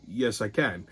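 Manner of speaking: low, soft deadpan; flat reply. Retold or imitated. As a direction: say it low and unbothered. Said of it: "I'm like".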